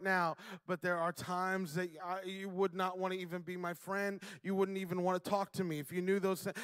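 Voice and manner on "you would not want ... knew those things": normal talking voice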